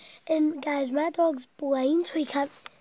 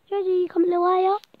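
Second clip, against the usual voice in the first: higher and louder call to the dog; 5 semitones higher, 5 dB louder, faster than usual. Real, not acted. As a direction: call Georgie over, coaxing. Calling her dog to bed.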